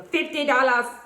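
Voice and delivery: loud, high mock demand; salon worker with attitude. Nail salon worker overcharging a customer, mock loud attitude. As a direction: imitate an overcharging salon worker barking the price. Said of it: "lady got an attitude with your ass".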